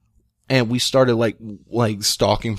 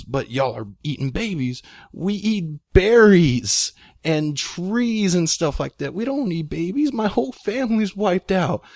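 lightly acted tearful plea, pitched higher; retold crying Yeti; 7 semitones higher than usual. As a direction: imitate a crying creature protesting its innocence. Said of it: "the Yeti's crying and goes".

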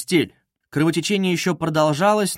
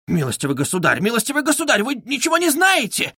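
shouting; 8 semitones higher than usual. A narrator's reading. Widow interrupting the calming official, crying out. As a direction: protest climbing to a high cry. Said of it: "кричала Катерина Ивановна".

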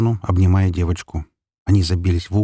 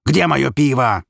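higher-pitched angry demand; angry yell; 6 semitones higher, 3 dB louder, slower than usual. A narrator's reading. Drunk father demanding his beer, angry yell. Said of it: "заорал он".

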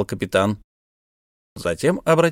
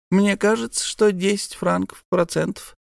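high, casual price; name the rate innocently; sly miser; 9 semitones higher than usual. Acted. Miser names the interest.

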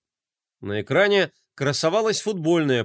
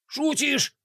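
much higher, scoffing question; scornful snort; 11 semitones higher than usual. Acted. Bublik dismisses the idea, scornful snort. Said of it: "фыркнул Бублик".

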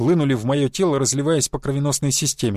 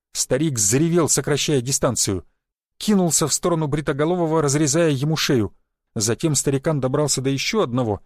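ordinary delivery, nothing marked

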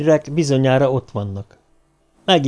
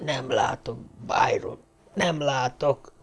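hushed, failing whisper of a dying man; very soft, faint voice of the badly injured; weak whisper; 9 dB quieter, slower than usual. A narrator's reading. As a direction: whisper it slowly, with almost no strength left. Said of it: "de csak suttogni tudott".

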